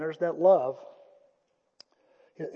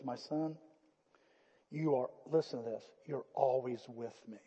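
much softer, gentle fatherly reply; tender father; 6 semitones lower, 14 dB quieter than usual. Acted. Father gently answering his angry older son, soft and tender.

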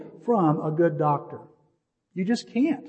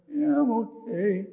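slow, higher, mock-groaning answer; groggy groan; 4 semitones higher, 5 dB quieter, slower than usual. Patient coming round answers the nurse, slow acted groan. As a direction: groan it out slowly, imitating waking from surgery. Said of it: "I wake up, gro-, I guess groaning".

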